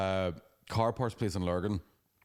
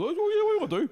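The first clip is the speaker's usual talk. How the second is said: high, bright put-on breeziness, louder; fake cheerful; 6 dB louder, faster than usual. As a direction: pretend nothing's wrong, chirpy and loud. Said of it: "I'm being all".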